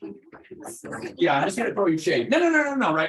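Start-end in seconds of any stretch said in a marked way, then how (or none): 0.0-1.1 judgy unvoiced hum with a side eye; a silent, judging hmm. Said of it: "I'm just gonna do the side eye and go". 2.3-2.9 quick protest. Real, not acted. Backpedalling after shade joke.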